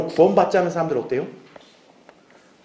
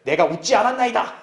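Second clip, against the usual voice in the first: higher, lightly acted scared denial; mock fearful denial; 5 semitones higher than usual. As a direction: deny it as a frightened Sarah, half laughing. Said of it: "사라가 두려워서 부인하며 말하기를".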